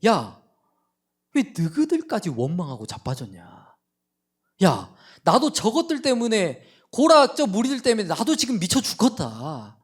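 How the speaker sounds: imagined God scolding Moses and David, loud and annoyed; exasperated scolding. Pretend. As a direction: tell them off like a fed-up boss. Preacher voices an exasperated God.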